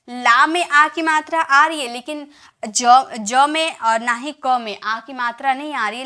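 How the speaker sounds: normal talking voice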